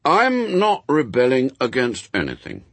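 reasonable. A narrator's reading. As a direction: explain it sensibly, as a child would. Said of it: "in a reasonable tone of voice".

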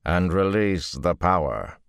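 slow, deliberate firm order; slow firm command. A narrator's reading. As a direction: leave no room to argue. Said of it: "he said firmly".

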